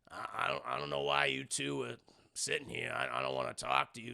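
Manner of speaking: put-on low, grumbling old man; grumpy old man. Joe grumbling at Davey and Tim, low and gruff. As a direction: grouse at the unwanted guests.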